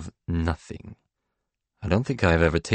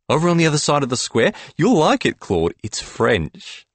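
higher, louder, quick bright suggestion; Ish's voice; 8 semitones higher, 3 dB louder than usual. A narrator's reading. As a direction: Ish pitching the new place to Claude, higher and quick. Ish voiced in read story.

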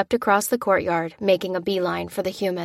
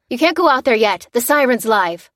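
loud, higher warning shout; called warning; 5 semitones higher, 7 dB louder than usual. Acted. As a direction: shout them back from the door. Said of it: "called the sergeant".

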